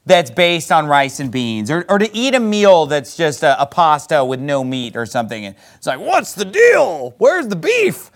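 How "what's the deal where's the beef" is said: high, drawn-out indignant cry; hammed-up complaint, stretched and pitched up; mock outrage. Kid faced with pasta with no meat, mock outrage. Mimicking kids upset at meatless meal.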